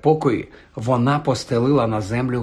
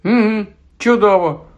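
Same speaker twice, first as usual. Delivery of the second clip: higher-pitched sarcastic praise; mocking; 6 semitones higher, 4 dB louder than usual. A narrator's reading. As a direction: feign being impressed. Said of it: "покипкував Гаррі". (кепкуючи, з іронією)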